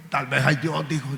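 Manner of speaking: low, soft, tentative admission; dying old man. Retold or imitated. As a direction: concede it quietly, almost under your breath. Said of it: "el viejito que estaba muriendo".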